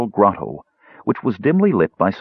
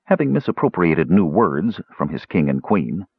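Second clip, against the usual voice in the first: ordinary delivery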